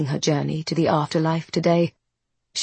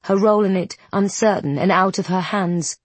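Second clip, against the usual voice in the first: ordinary delivery, nothing marked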